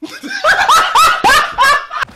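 high-pitched screechy cackle; real high-pitched laughing fit; shrieking laugh. Real, not acted. Burst of laughter while playing.

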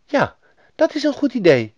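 put-on high, eager little girl; friendly young robber girl, voice well up. A narrator's reading. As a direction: agree in a child's high-pitched voice. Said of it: "zei het roversmeisje".

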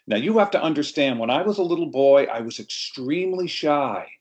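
usual speaking voice

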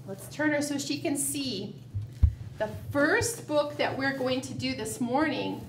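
ordinary delivery

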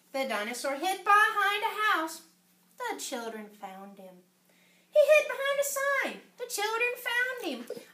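animated, sing-song storybook narration; story reading to children. A narrator's reading. Reader telling children about Danny's dinosaur at the zoo, animated storytelling. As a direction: read it out expressively for young listeners. Reads picture book aloud to children.

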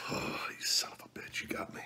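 hoarse, strained whisper from the heat; pained whisper. Real, not acted. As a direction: force it out barely voiced. Pepper heat hits him.

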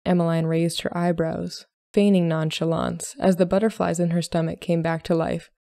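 plain reading voice

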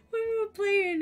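high, loud squeal through laughter; cracking up. Real, not acted. Streamer retelling a funny game story, cracking up. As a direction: squeeze the words out while cracking up.

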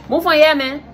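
loud Liberian "move on"; Liberian accent. Shown as a demonstration. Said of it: "Liberian accent".

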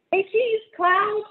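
put-on high, loud child voice calling say cheese; a delighted kid snapping pictures; excited little girl. Ruthie photographs everything with her tiny camera, high and gleeful.

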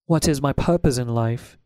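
ordinary delivery

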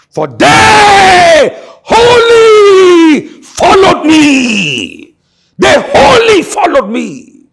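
slow, high, loud crying out; impassioned cry. Real, not acted. Preacher crying out the verse to the congregation, slow and loud. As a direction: wail the words out long and high.

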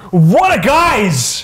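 slow, high shouted What a guys; high slow drawn shout; catchphrase shout. Acted. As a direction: belt out the catchphrase. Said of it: "let's point to ourselves and go".

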